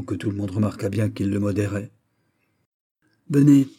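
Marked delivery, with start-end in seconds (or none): 3.3-3.7 curt angry order. A narrator's reading. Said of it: "répliqua le duc pour toute réponse".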